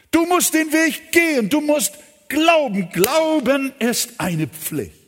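a preacher demanding faith from the congregation; preaching insistence. Acted. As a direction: drive the command to believe home. Preacher presses duty to believe.

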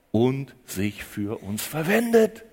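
"verwendet" stretched, slow and low. Acted. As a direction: let "und sich für uns verwendet" sink slowly, low. Preacher repeats the phrase twice.

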